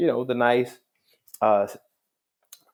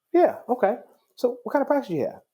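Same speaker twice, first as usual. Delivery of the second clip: high, hurried brush-off; oblivious retelling; faster than usual. Retold or imitated. Young man still asking the judge his practice, oblivious. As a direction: imitate someone missing the hint and pressing on. Retold man ignores the badge.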